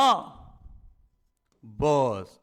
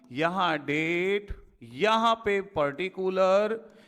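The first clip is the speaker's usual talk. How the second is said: slow dictation; slower than usual. Shown as a demonstration. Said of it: "slow board dictation".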